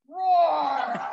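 big, loud imitated lion roar. Shown as a demonstration. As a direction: roar out to rally the class. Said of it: "roar loud".

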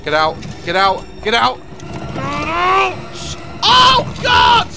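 frantic high-pitched yelling; panicked shouting. Real, not acted. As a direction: scream to get out in time. Trap closing, rushing out.

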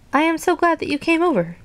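high-pitched glad storybook voice; grateful relief. A narrator's reading. Toad to Frog after the nightmare, grateful relief. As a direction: thank a friend in a high, relieved voice.

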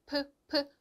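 프 repeated, short and airy; two quick airy 프; Chinese p sound. Shown as a demonstration. Teacher drills the Chinese p, twice. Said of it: "피가 아니라 프".

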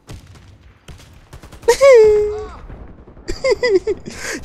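loud squealing laugh; high excited laugh. Real, not acted. After the kill.

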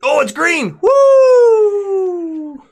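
high, loud whoop of relief; elated cheer. Real, not acted. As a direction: shout it high and celebrate, stretching the woo. Celebrates a lucky green card.

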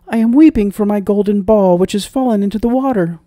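high, loud princess voice; high-voiced princess, startled and upset; weeping princess. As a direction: play a distressed young princess, pitched well up. Startled princess answers the frog.

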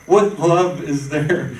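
slow, loud outraged question; loud, slow, disbelieving; indignant outcry. Real, not acted. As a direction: drag it out, loud and incredulous. Preacher exclaims about choking servant.

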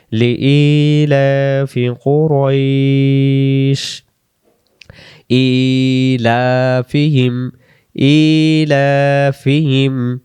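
slow, drawn-out chanted recitation of each syllable; slow Quran recitation. Shown as a demonstration. Teacher reciting slowly for students to repeat.

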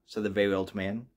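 ordinary delivery, nothing marked

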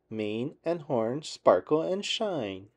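ordinary delivery

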